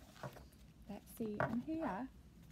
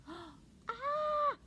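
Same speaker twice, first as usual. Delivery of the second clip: high, loud squeal of fright; gasp, then shriek high; squeaked shriek; 13 semitones higher, 5 dB louder than usual. A narrator's reading. Octopus spotting the fierce eel, high squeaked shriek. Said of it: "squeaked Octopus".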